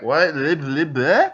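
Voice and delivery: puzzled babble rising into a question; perplexed gibberish. Acted. Rival unaware he was cursed, perplexed babbling. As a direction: babble nonsense, baffled by your own tongue. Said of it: "then he looks perplexed".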